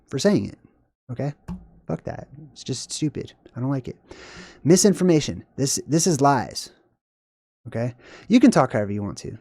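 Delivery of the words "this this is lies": normal talking voice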